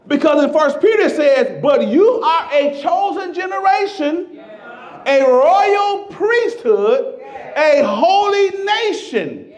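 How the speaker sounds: loud, high, slowed preaching; full-force preaching. Acted. Pastor preaching to the congregation, loud and high. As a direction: preach it out to the rafters.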